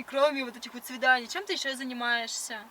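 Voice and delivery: ordinary talk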